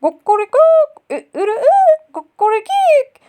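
very high, loud cock-a-doodle-doo; piercing imitation of a rooster's crow. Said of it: "horoz peşinden bağırmış".

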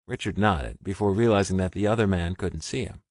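ordinary delivery

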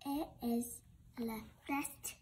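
usual speaking voice

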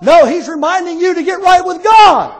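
high, loud preaching shout; shouted exhortation. Real, not acted. Preacher reminding the congregation to get right, shouted exhortation. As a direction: build to a long, ringing final word.

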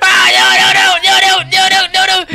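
loud, rapid string of refusals; frantic game panic; panicked pleading. Real, not acted. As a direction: cry out in alarm as it closes in. Monster chasing the player.

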